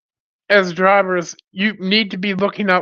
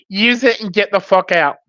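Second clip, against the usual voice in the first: ordinary talk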